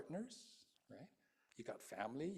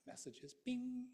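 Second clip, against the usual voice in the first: mock ping; 11 semitones higher, slower than usual. Imitating phone notification.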